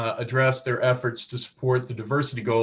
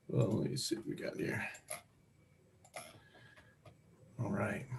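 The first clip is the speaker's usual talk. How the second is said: very soft, half-voiced mumble while checking; muttering to self; 3 semitones lower, 15 dB quieter than usual. Real, not acted. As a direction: talk under your breath as you fiddle with something. Muttering to self while sharing screen.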